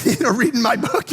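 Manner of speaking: ordinary delivery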